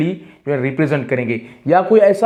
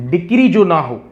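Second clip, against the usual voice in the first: normal talking voice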